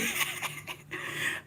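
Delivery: breathy laugh-cry; happy tears sound; laugh turning to sob. Real, not acted. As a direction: laugh that breaks into crying. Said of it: "I'm already crying".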